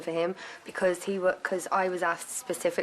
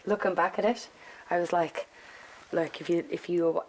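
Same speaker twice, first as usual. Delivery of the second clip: usual speaking voice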